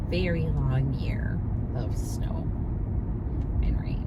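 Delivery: weary whisper. Real, not acted. Weary about long winter.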